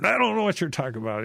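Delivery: quick, high-pitched acted protest; stronger denial. Peter denies Jesus a second time, stronger denial. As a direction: retell it as a rushed, insistent denial. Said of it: "Peter even gets stronger every time".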